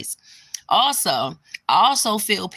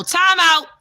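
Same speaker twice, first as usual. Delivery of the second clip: loud time out; 10 semitones higher, 7 dB louder than usual. Real, not acted. Calling a halt.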